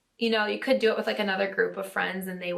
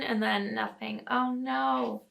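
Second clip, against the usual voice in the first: ordinary talk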